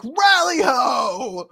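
chorus of hearty greetings, first loud and high, then fading; crowd greeting. Retold or imitated. Villagers greet the arriving party, hearty calls fading away. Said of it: "everyone is like".

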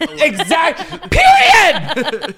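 loud, high laughing "period!"; laughing shout. Real, not acted. Cheering on a friend's joke, shouting through laughter. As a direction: shout it with glee.

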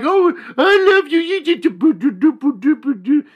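put-on high, loud gushing baby talk; baby talk to a dog. Owner cooing at her dog, high baby talk. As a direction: coo at the dog like it's a baby. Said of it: "you're like".

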